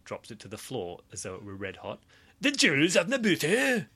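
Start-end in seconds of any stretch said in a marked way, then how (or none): 2.4-3.9 put-on high, loud German-accented captain; hammed-up German accent. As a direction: shout it in a bad German accent. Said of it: "That was German".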